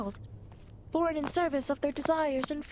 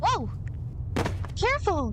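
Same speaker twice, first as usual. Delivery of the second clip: startled cry; 5 semitones higher than usual. Acted. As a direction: call out as they slip. Traveler slips on ledge.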